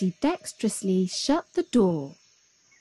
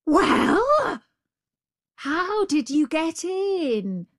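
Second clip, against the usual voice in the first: much higher, drawn-out question; wheedling, puzzled jackal; inquisitive jackal; 10 semitones higher, 4 dB louder, much slower than usual. A narrator's reading. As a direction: ask innocently in a high voice.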